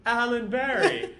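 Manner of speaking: high, loud, drawn-out name call; mock exclamation. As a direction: hail him theatrically, half-laughing. Villain greets drunk man by name.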